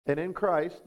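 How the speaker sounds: ordinary delivery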